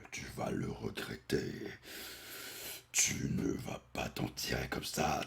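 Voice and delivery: low breathy threat; menacing whisper. Retold or imitated. The monster threatening Goku, in a low menacing whisper.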